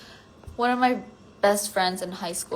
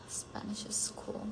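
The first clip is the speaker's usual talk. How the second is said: whispered aside, unvoiced and slow; slow, toneless whisper; 15 dB quieter, slower than usual. Real, not acted. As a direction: breathe it as a private aside.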